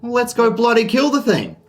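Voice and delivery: high, loud battle-ready dad; rally everyone to the fight; fired-up shout. Acted. Dad rallies to fight the dragon.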